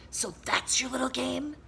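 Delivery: witch voice. Acted. Swamp voice.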